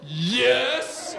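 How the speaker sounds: high gasp, then excited yes; hammed-up childish glee; thrilled kids. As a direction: squeal it like delighted children. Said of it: "both of my kids went".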